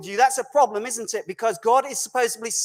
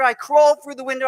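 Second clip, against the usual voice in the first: usual speaking voice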